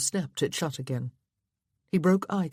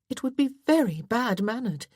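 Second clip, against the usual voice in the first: higher voice, leaning on "very"; stress on VERY; 7 semitones higher than usual. A narrator's reading. Wife scolding husband over manners, "very" emphasized. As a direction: reproach him, "very" pushed out with emphasis. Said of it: "She had emphasized the word".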